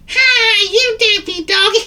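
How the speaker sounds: very high, loud squeaky bird voice; piercing cartoon bird laughter; giggling bird. A narrator's reading. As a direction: tease shrilly, then burst into giggles. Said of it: "She giggled".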